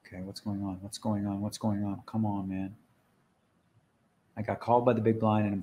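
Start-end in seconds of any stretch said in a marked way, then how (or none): 0.1-2.7 soft, low repeated muttering; low, quiet pleading under the breath; muttered impatience. Real, not acted. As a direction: mutter anxiously at a stalled screen. Waiting on frozen game again.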